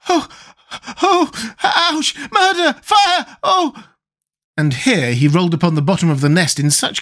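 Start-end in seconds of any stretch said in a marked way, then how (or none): 0.1-3.8 very high, drawn-out wails of agony; pained, alarmed cries. A narrator's reading. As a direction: cry out as if writhing from stomach pains. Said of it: "pains that he became alarmed".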